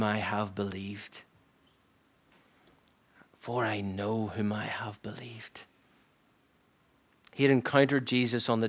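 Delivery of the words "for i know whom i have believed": near-whispered, slow reverent verse; hushed testimony. Retold or imitated. Preacher echoing the apostle at the end, hushed. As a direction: repeat it softly and slowly, letting it sink in.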